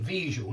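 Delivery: вижу with a zh sound in the middle; zh in place of d. Shown as a demonstration. Teacher shows the letter change, вижу with zh. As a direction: say вижу low and falling, middle consonant zh. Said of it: "one letter change in the I form".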